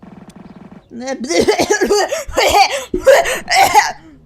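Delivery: big high burst of laughter; loud laughing fit. Real, not acted. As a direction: crack up out loud.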